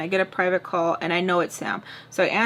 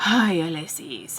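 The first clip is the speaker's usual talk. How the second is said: sighed, slow, disappointed name-call; exasperated sigh; 3 semitones lower, slower than usual. Retold or imitated. Her partner scolding her by name, a sighed disappointed tone. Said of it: "don't say my name like that".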